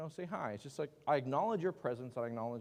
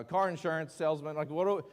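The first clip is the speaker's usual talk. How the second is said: ordinary talk, nothing marked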